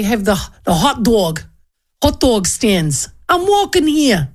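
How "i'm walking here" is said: brash New York cabbie "I'm walking here"; New York accent. Shown as a demonstration. Radio host doing a quick New York accent bit. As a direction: bark it like a New Yorker in traffic. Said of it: "That's, that's my New York".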